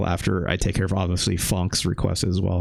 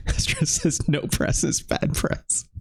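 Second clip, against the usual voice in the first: laughing through the words, higher and slower; 6 semitones higher, slower than usual. Real, not acted. Streamer amused by Australia's pirate day searches, laughing. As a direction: let a breathy laugh carry the line.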